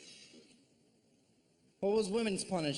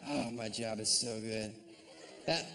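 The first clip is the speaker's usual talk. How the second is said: normal talking voice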